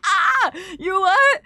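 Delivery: high, loud, slow drawn-out "you what?"; hammed-up stunned reaction; mock disbelief. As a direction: stretch it out in fake outrage. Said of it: "and then I'm like".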